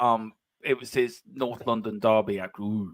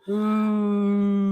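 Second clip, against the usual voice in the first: high drawn-out pantomime boo; mock boo; 11 semitones higher than usual. Tottenham fan teasing the Arsenal co-host, mock boo. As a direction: boo the rivals in jest, stretched high. Mock booing the winning team.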